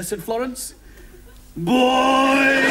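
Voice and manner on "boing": loud, high, held imitated boing; drawn-out cartoon spring noise. Zebedee bouncing into the story, loud comic boing.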